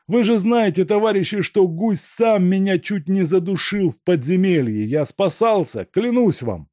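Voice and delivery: high, anxious voice of a man talking his way out; pleading captive thief. A narrator's reading. Makarka begs his captors to believe him, pleading. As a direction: swear innocence, pleading.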